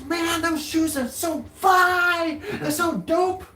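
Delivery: high, excited hype voice; hyped street guy. Retold or imitated. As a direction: pump him up like a fan. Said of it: "He goes".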